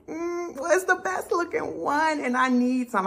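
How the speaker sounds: high-pitched, reluctant justifying; acted-out sheepish insistence; defensive hedging. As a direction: argue weakly in a high, small voice. Defending the wig to her friend.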